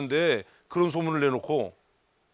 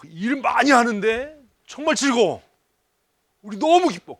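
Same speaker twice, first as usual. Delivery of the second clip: mock joyful member; 7 semitones higher, 9 dB louder than usual. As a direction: ham up delight in hard church work. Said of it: "어떻게 소문이 났어야 되는 거예요?".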